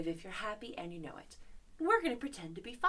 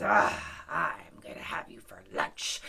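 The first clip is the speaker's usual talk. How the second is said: very low, rough growling lion; menacing lion; 5 dB louder than usual. Acted. Lion threatens to eat mouse, menacing lion. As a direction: growl it low, opening with a loud ah. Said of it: "And the lion says".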